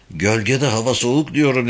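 ordinary delivery

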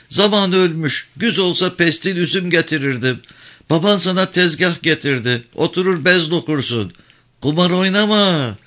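high, pleading village woman voice; worried old mother. Acted. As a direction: press her son with anxious advice. Mother hands gifts.

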